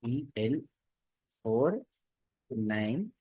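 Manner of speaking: halting, one word at a time; staccato. Real, not acted. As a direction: pause between each word. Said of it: "रुक रुक के बोल रहे हैं".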